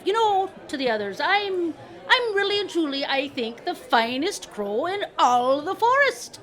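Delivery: high, swelling boastful crow. Acted. Crow bragging to the other two, boastful. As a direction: brag grandly, drawing out the ending.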